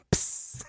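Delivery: short unvoiced psst; hissing flame sound. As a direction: hiss like a candle snuffed out. Imitating a flame going out.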